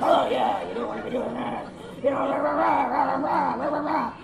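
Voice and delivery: mock macho cop blather; swaggering know-it-all cop; blustering tough-guy cop. Mocking cops posing as Navy SEALs.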